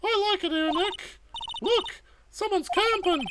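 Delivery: put-on chirpy young sidekick; young girl voice. Maya's lines, higher girl voice.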